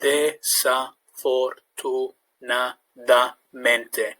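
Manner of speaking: slow but smoother; Desafortunadamente, slow, building up speed; sounding out words. Shown as a demonstration. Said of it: "build up speed".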